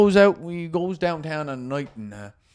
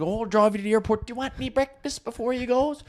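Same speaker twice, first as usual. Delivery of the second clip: mock cheerful mother; 9 semitones higher, 3 dB quieter than usual. Retold mother's cheerful offer.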